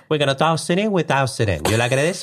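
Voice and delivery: high-pitched, singsong Italian patter; effeminate Italian accent. Retold or imitated. Husband showing things to his wife while packing, high Italian accent. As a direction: pitch it up like a playful Italian mover. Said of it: "just looking at Ellie and going".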